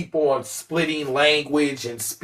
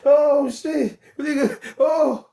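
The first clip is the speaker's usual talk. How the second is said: mock shock; 12 semitones higher, slower than usual. Mock amazed reaction.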